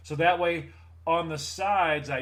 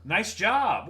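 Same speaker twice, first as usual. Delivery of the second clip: sarcastic; 5 semitones higher, 6 dB louder, much slower than usual. Retold or imitated. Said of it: "And I'm like".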